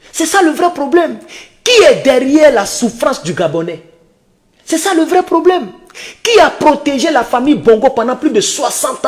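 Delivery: high-pitched rhetorical refrain, repeated; rousing refrain. Real, not acted. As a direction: hammer the refrain to the crowd.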